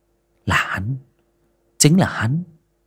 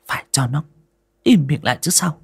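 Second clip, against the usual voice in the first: through gritted teeth; 7 semitones higher than usual. Acted. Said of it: "nghiến răng nghiến lợi".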